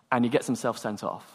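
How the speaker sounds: usual speaking voice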